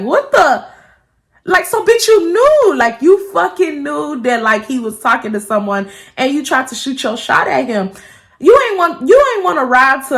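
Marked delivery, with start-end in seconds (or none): 0.0-0.7 high, indignant reaction; upset disbelief. Retold or imitated. Girlfriend hearing what the girl did, high indignant reaction. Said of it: "went from being upset to like". 1.4-4.4 high-pitched, heated call-out; indignant rant. Acted. Girlfriend calling out the girl who knew, high and indignant. 8.4-10.2 high, loud accusation; indignant call-out. Acted. Girlfriend accusing girl about the ride, high indignant call-out.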